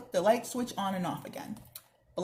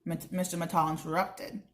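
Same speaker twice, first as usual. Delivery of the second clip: ordinary delivery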